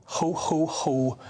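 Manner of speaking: dry, spoken-out ho-ho-ho; sarcastic mock laugh. Real, not acted. Commentator scoffs at the safe and effective slogan, sarcastic mock laugh. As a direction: scoff with a deadpan fake laugh.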